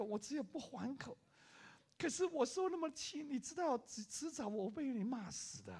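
a wife's gentle complaint; imitated wife's pleading; sad wife. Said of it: "非常的忧郁的对我说".

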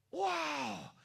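high, loud drawn-out wow; amazed wow. Acted. Preacher marvels at the muscles growing back, amazed. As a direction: burst out in amazement. Exclaims at miraculous muscle regrowth.

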